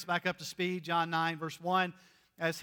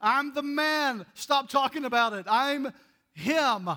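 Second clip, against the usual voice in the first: higher, louder, exasperated insisting; healed man insisting; 5 semitones higher, 4 dB louder than usual. Retold or imitated. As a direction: cut the gossip short, it's you. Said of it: "here's this guy like".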